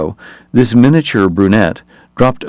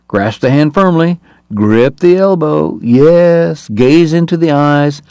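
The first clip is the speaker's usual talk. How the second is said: higher, slow mock-earnest politician patter; 6 semitones higher, slower than usual. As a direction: lightly ham up a glad-handing politician. Eddie mocks a politician's handshake.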